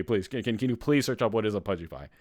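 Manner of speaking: ordinary talk, nothing marked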